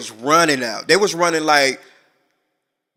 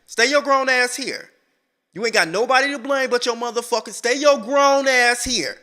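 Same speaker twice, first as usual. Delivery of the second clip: scolding mama; 8 semitones higher than usual. Acted. Imagined mama leaving a daughter behind, scolding. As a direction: play a fed-up mama, pitched up and emphatic.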